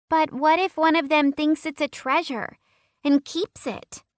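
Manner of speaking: put-on high worried, insistent girl; a girl fretting over her treasure; demanding little girl. A narrator's reading. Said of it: "she demanded".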